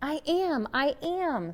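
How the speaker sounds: silent shout. A narrator's reading. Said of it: "Sylvester wanted to shout".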